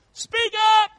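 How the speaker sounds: high, loud preached shout; shouted call. Real, not acted. Preacher shouting his third point, high and loud. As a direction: fire the point at them.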